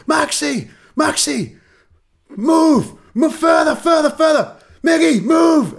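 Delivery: high, loud touchline shout of instructions; imitating a shout. Sub warming up, yelling positions at teammates, loud. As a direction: bark it across the pitch like a coach. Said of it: "you could hear him going".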